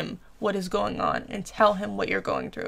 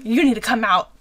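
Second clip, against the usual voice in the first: louder, pushy retold demand; forced demand; 6 dB louder than usual. Friend mimicking a comment she didn't make, forceful. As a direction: press it like an order, stressing need. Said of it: "a forced, like".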